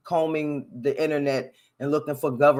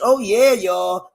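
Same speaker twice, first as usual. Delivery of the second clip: hammed-up columnist voice; 5 semitones higher, 6 dB louder, slower than usual. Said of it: "He writes".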